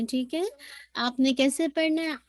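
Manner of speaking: ordinary talk, nothing marked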